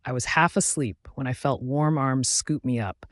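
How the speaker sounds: usual speaking voice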